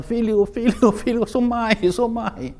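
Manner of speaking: chamado agudo e insistente de mãe; mãe chamando o filho. Retold or imitated. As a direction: chame o filho pela porta, voz bem mais aguda. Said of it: "Ah, chama". (mãe chama o filho na madrugada, voz aguda)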